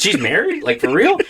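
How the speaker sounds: high, laughing surprise; shocked disbelief. Retold or imitated. Hearing a coworker is married, high laughing shock. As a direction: jump up in pitch, amazed. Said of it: "I was like".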